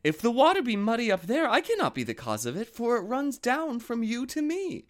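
high timid lamb. A narrator's reading. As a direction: plead in a high little lamb voice. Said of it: "said Lambikin".

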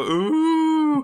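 long high held "ooh"; collective awed ooh. Acted. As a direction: coo loudly in mock wonder. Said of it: "they all go".

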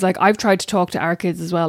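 normal talking voice

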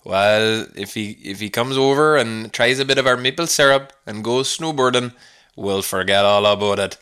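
butchered Canadian accent, mock-folksy; badly faked Canadian accent. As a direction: put on a corny Canadian twang. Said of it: "my Canadian accent".

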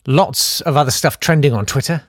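ordinary talk

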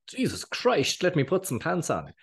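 high, startled mock fluster. Caught off guard going live, mock flustered and high. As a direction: play it up as if caught undressed.

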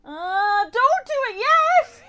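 real high, drawn-out, tearful plea; terrified. Real, not acted. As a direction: beg in fear, dragging it out. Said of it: "I'm terrified".

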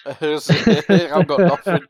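slow, loud laughing confession. Real, not acted. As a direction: laugh through the words. Laughing admission.

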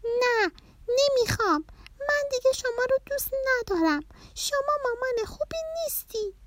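high-pitched tantrum voice; defiant child's squeal at a parent; loud angry child. A narrator's reading. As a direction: refuse loudly like an upset little girl. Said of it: "پرناز با صدای بلند گفت".

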